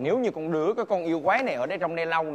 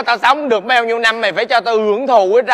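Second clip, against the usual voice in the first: loud bickering; 5 semitones higher, 7 dB louder than usual. Acted. Master argues with disciple.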